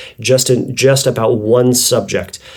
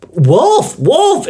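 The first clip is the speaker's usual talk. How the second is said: high, louder desperate cry of wolf; real wolf alarm; 4 dB louder than usual. Retold or imitated. Boy crying out to the village as the wolf truly comes, high desperate cry. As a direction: shout for help, a wolf is really here. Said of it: "he goes".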